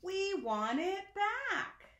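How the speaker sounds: high, slow sheep complaint; high pleading sheep; slow sheep plea. Acted. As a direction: drag it out like a sulky sheep. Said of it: "they cried".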